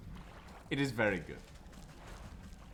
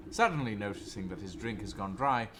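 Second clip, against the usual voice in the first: ordinary delivery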